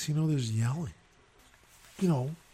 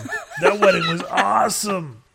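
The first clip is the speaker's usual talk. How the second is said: high, loud enthusiastic praise; gleeful rave; 6 semitones higher, 9 dB louder than usual. Retold or imitated. Guest to the bride afterwards, gleeful rave. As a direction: gush loudly over a glorious disaster. Tells bride the fight was awesome.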